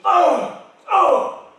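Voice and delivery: mock British frustration sounds; passive-aggressive sighs. Shown as a demonstration. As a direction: huff pointedly at the broken machine. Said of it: "like that".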